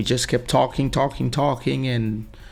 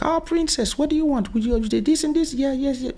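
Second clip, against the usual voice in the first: much higher sweet voice for the princess; doting restaurant staff. Retold or imitated. Staff greeting the daughter, high cooing voice. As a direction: coo over her like a pampered princess. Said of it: "everybody was like".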